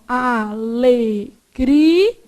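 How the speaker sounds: "Alegria" split into a, le, gri, a, each drawn out; teacher separating "Alegria" into long, distinct syllables. Shown as a demonstration. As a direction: say "Alegria" very slowly, pausing after every syllable. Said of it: "Como é que vai fazer".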